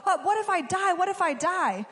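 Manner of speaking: high, panicky mock fear; a hint of frightened queen. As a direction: play Esther freaking out, lightly. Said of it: "Esther was, like, freaking out".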